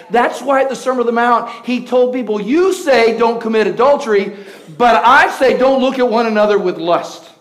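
loud, high, driving sermon voice; thundering pulpit delivery, swelling to shouts; full-force preaching. Acted. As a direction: preach it big to the whole church.

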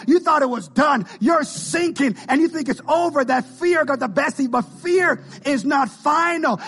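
high, driving sermon climax; full-force preaching. Real, not acted. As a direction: preach it out in emphatic, rising cries.

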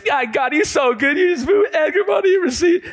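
very high, loud jubilant shouting; teen rushing home shouting praise to his mom; joyous. Retold or imitated. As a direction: burst through the door rejoicing. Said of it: "I came in all joyous".

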